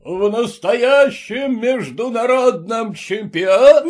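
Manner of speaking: ringmaster's booming call; booming announcer roar. A narrator's reading. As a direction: roar it out to the arena. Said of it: "взревел своим зычным голосом".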